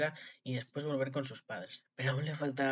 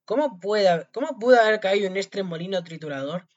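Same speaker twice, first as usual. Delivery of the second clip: loud, raised, dismayed question; exclaiming; 6 semitones higher, 12 dB louder than usual. A narrator's reading. Tom Thumb wakes inside the cow, exclaiming. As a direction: wonder aloud at high volume. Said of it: "exclamó".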